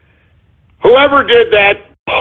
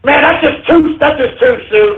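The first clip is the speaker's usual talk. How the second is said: high, shouted disgust; hammed-up shock; appalled outcry; 6 semitones higher than usual. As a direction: recoil in mock horror. Shocked by offensive username.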